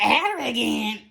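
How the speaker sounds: playing Kandi's hammed-up outrage; mock indignant Kandi. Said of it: "And she was like".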